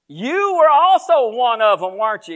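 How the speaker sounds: acted, ringing challenge; loud accusation. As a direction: call someone out in front of everyone. Second accuser confronts Peter.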